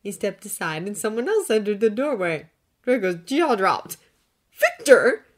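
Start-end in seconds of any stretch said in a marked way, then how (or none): 4.5-5.2 high, loud stunned exclamation; breathed in surprise. A narrator's reading. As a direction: gasp out his name. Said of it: "he breathed".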